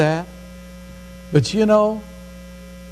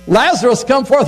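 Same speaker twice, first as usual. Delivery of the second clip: Jesus calls his friend out of the grave, raised and commanding; 5 semitones higher than usual. Retold or imitated. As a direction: call Lazarus out of the tomb with a raised voice. Retelling the call, acted out.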